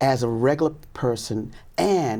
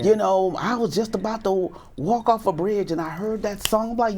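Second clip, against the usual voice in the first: usual speaking voice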